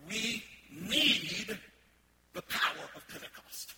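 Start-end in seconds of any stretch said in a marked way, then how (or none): 0.1-1.6 "need" drawn out, loud and higher; stress on need. Real, not acted. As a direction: hammer "need" as if in bold red type. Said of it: "I want to emphasize that word need".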